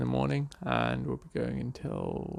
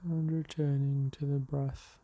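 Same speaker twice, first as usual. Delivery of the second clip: unhurried meditation voice with long pauses; guided meditation; slower than usual. Real, not acted. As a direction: guide them gently into the breath, leaving space between phrases. Leads a morning meditation.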